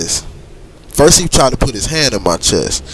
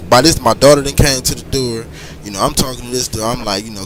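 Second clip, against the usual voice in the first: usual speaking voice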